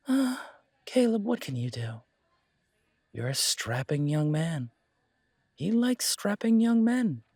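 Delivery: sly barkeep having fun at his friend's expense; teasing barkeep. Acted. Barkeep Trey teasing Caleb.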